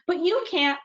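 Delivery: high-pitched, lightly voiced child's protest. Child objects to brother in staged quarrel, mock protest.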